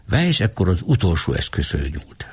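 ordinary delivery

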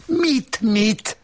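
very high sharp snap; the wife flaring up at the narrator; snapping. Acted. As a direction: snap back at him, shrill with anger. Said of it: "Csattant fel az asszony".

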